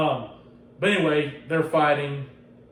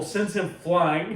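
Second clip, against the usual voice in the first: usual speaking voice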